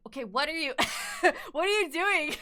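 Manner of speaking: incredulous question that breaks into giggles; laughing disbelief. Retold or imitated. Artist baffled by a creator's recent work, laughing. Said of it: "And now I'm like".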